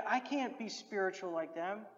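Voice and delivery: ordinary delivery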